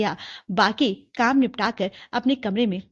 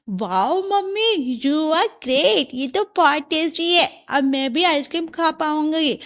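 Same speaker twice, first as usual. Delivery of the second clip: high, drawn-out wow of delight; delighted child; 6 semitones higher, 3 dB louder, slower than usual. Acted. Child praising mother's chocolate shake, delighted. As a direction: gush in a high voice, stretching the praise.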